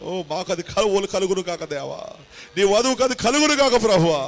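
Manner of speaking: loud, high, impassioned intercession; fervent prayer. Acted. Pastor praying over the live audience, loud and fervent. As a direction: cry out to God with full force.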